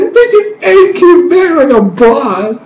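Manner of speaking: high, drawn-out fan squeal; so excited. Retold or imitated. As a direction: gush triumphantly over a hero's return. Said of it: "That's when I got so excited".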